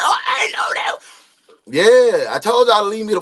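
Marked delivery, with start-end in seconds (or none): 0.0-1.0 high laughing quote of scared bullies; laughing mock surprise. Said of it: "they were like".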